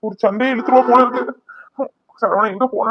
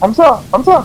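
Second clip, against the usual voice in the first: urgent, loud and high calls; calling out; 6 semitones higher, 4 dB louder than usual. Acted. Husband urgently calling his fainted wife to get up. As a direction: shake her awake with your voice.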